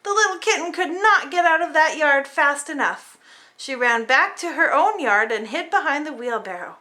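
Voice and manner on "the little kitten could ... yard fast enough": plain reading voice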